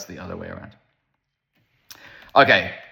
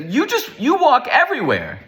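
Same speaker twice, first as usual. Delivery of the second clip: lightly voiced old man, much higher; older man's remark; 3 dB louder than usual. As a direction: pitch up high for the old man's friendly jab. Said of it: "he's like".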